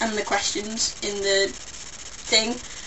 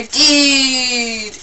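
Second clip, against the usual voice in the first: one long, loud, stretched word; mock cool greeting; 3 semitones higher, 6 dB louder than usual. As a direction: hail a pal with an overdone drawl. Acts out casual greeting to friend.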